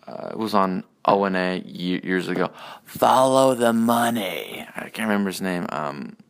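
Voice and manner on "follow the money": slow, drawn-out impression of a pundit; put-on voice, drawn out; imitated catchphrase. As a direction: stretch every word like a catchphrase. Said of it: "Cumia did his impression of him".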